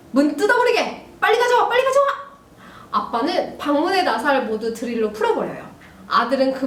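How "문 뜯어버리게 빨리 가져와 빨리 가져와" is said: high, urgent shouted orders; acted angry dad. Dad orders drill to rip door off, acted angry shouting. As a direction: bark commands as an enraged father.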